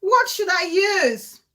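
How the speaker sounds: high, loud reading of a question; puzzled question. Retold or imitated. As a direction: ask it as if stumped. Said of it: "the lily say".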